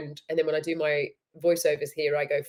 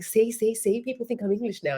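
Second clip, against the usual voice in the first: triumphant retold boast; 6 semitones higher than usual. Retells teasing partner about accent.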